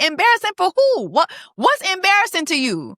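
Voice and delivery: high-pitched mock bafflement; imitating incredulous husband. Drunk husband questions embarrassed wife, mock disbelief. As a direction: challenge her, comically incredulous. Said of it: "And he's like".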